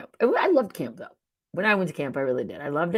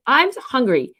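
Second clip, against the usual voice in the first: mock chirpy host; 9 semitones higher, 4 dB louder, faster than usual. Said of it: "they do it in a negative way".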